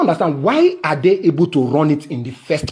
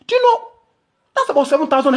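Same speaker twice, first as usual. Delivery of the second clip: incredulous; 10 semitones higher, faster than usual. Real, not acted. Ranting about ten dollar charge.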